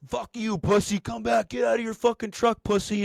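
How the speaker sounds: high-pitched angry heckling; mock screaming. Gate workers yelling at departing driver, high mock screaming. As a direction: hurl insults in a high mimicking yell. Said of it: "they're both fucking screaming".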